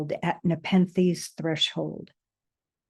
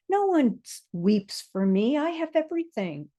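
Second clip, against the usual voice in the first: high, fast defiant protest; defiant outburst; 7 semitones higher, faster than usual. Acted. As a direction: deny it in a rushing outburst. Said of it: "you shout".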